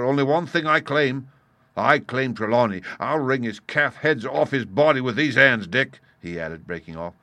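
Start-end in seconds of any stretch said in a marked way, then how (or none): 0.0-6.0 loud, emphatic threat; menacing pirate leader. A narrator's reading. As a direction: stake your claim with force. Silver claims his victim.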